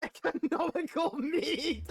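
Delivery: high, slow squeal breaking into laughter; helpless laughter. Real, not acted. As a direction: squeeze it out just before cracking up. Cracking up at the game.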